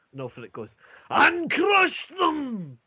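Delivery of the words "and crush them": very high, loud, drawn-out bellow; war cry. Acted. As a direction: scream it like a battle cry. Ulfric bursts in with a war cry.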